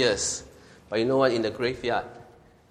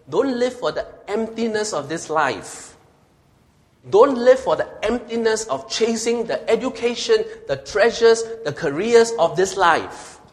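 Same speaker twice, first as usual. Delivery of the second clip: high, loud pleading sermon cry; fervent exhortation; 9 semitones higher, 4 dB louder than usual. Acted. Preacher urging Christians not to chase worldly things, loud and high. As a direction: plead with them, voice lifted high.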